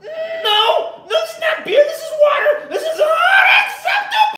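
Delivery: very high, shouted, slowing on unacceptable; Lemongrab impression. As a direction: shriek UNACCEPTABLE with outraged fury. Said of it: "I've been doing Lemongrab for fucking ever".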